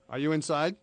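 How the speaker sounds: usual speaking voice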